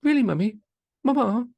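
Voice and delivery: high, loud mock child to mother; hammed-up grown sons appealing to mama; imitating pleading sons. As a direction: whine at mummy in a put-on high voice. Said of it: "they're gonna be like".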